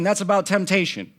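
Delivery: high, forceful sermon delivery; emphatic preaching. Acted. Preacher correcting a misread passage for the congregation, emphatic. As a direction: drive the point home to the room.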